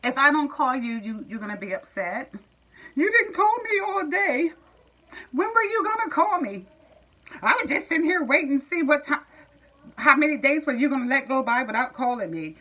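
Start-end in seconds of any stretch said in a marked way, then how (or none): 3.0-12.6 high whiny mock complaint; mocking a clingy boyfriend's whine; mocking whiny man. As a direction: imitate him nagging about calls, pitched up.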